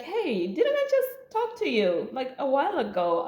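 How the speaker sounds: lady recognizing her, high and friendly; sweet lady voice. Retold or imitated. Kind lady voiced at checkpoint.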